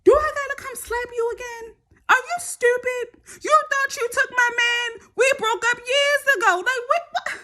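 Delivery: put-on high, sneering girl on the phone; mock her pushy message; mocking taunt. Voicing the girl's taunting message.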